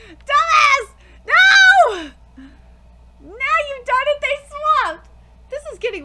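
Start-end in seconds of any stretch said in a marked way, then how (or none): 0.1-2.1 high, loud frantic shout; loud yell. Real, not acted. Yelling at the character. 2.4-5.1 panicked. Real, not acted. Reacting to the swap.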